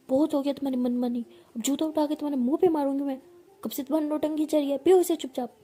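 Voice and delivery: rising loud, fast angry telling-off; wife scolds her stubborn partner, building to a yell; furious scolding. Acted. Rising angry scolding. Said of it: "बहुत ज़्यादा भड़क रही होती है".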